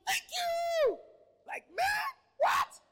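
very high, loud joyful cry; excited shout. Acted. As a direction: yell thanks in delight. Congregation reacting to a gift.

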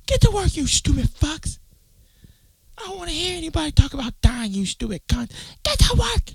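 high, barking tyrant boss; cruel boss voice. Pretend. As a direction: yell down at workers like a villain. Imagined cruel penthouse boss.

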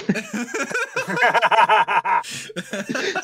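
long high giggling laugh; can't stop laughing at his own story; real laughter. Real, not acted. Telling mates about a sleeping soldier, laughing hard.

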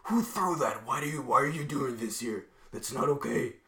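high, loud scolding from an angry teacher. Retold or imitated. Teacher leaning out of the window scolding the kid, loud and angry. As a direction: bark at the kid who threw it.

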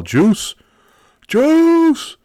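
high, loud drawn-out shout; imitated crowd yell. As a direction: holler it like a rowdy sales office. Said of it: "everybody would yell".

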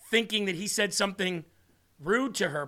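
usual speaking voice

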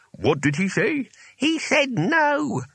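high, querulous exchange; high, drawn-out muttering masters; conferring old wizards. A narrator's reading. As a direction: pass the word on in high wizardly voices. Wizards confer behind the door.

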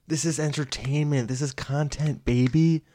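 high, hammed-up hype-man voice; mock showbiz hype. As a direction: sell it like a parody promoter. Hyping content in mock self-rebuke.

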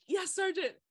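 high reply breaking into a laugh; giggly yes sergeant. Retold or imitated. Recruit confirming the bear story to the sergeant, laughing. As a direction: answer with a grin you can't hide. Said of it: "he was like".

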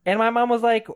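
ordinary talk, nothing marked

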